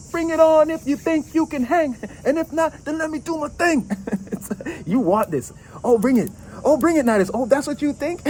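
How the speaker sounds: high sassy bring-it-on voice; cocky taunt. Pretend. Querent daring the rival Knight, high cocky taunt. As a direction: goad them into a fight, high and playful.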